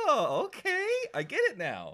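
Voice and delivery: high, loud, cracking eureka; excited realization. Retold or imitated. Recalling figuring out the F U as a Lesnar shot, excited. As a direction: blurt it as the penny drops. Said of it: "I was like".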